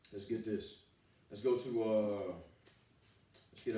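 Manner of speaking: ordinary talk, nothing marked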